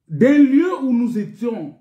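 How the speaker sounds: ordinary talk, nothing marked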